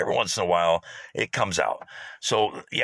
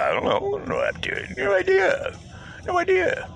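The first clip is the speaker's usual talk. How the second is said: very high put-on innocent voice; playing dumb. Hiding her radio from the coworker, mock innocent voice. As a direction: squeak out fake innocence.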